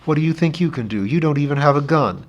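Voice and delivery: lightly voiced young girl, pleading; girl's voice. A narrator's reading. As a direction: voice the girl, urgent and worried. Betty's lines in the story.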